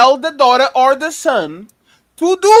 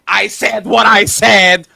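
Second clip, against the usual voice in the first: slow, loud mocking quote; mocking shout; 4 semitones lower, 7 dB louder, slower than usual. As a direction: throw the line back, slow and loud. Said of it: "they think that saying".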